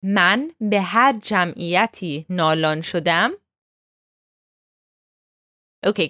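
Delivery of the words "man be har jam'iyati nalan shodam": the whole line spoken slowly and evenly; slow model line. Shown as a demonstration. Teacher has students say the full line together. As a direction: recite it slowly in one go. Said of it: "And all together".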